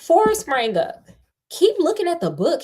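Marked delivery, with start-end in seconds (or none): 1.5-2.6 urgent hiss. Acted. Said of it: "he hissed urgently".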